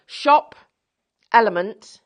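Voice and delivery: loud, punchy announcement; announcer voice. Acted. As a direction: announce it like a game show host. Announcing the club name.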